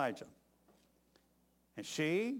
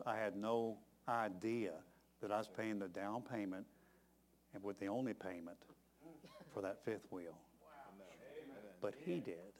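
hushed; 6 semitones lower, 11 dB quieter than usual. Real, not acted. Hushed moment of awe.

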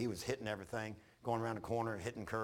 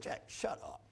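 mock whisper telling Jack to hush; whispered jab; 4 semitones higher, 4 dB quieter, slower than usual. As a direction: whisper it as a teasing aside.

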